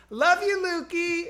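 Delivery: high, drawn-out sing-song goodbye; doting mom calling out. Retold or imitated. Friend's mom calling from the car window, doting. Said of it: "and she goes".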